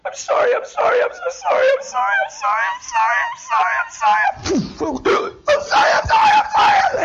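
wailing sorry in a very high crying voice; imitating sobbing apology. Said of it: "guess what she's saying? Repetitively".

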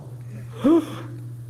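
sharp gasp of sudden realization; acted startled gasp. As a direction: suck in air as if struck by a thought. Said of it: "What's their first thought?".

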